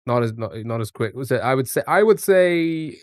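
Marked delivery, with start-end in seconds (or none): 1.9-3.0 slow, drawn-out and louder. Real, not acted. Streamer stretching out his guess, slow and loud. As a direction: stretch it out while deciding.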